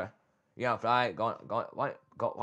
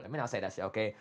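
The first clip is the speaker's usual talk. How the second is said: restraining himself; 4 semitones lower, 8 dB quieter, faster than usual. Real, not acted. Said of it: "Let me calm down".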